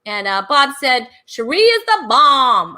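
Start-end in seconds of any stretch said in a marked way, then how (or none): 1.3-2.8 a lightly voiced, louder mock of Bob. Host mocking guest Bob's compliment in a lightly put-on voice. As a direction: toss it off like a goofy catchphrase. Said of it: "I don't know why I'm talking like that".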